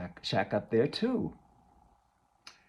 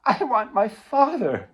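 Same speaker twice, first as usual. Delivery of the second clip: crying out; 8 semitones higher, 8 dB louder than usual. Acted. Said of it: "But Laura starts to cry".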